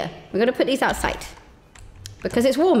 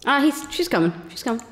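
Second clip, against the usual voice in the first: usual speaking voice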